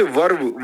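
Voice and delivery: वर्ब with the r sounded; wrong pronunciation. Shown as a demonstration. Teacher mimicking what students say, वर्ब with a spoken r. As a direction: say वर्ब with a clear "r" before b. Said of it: "वर्ब नहीं होता".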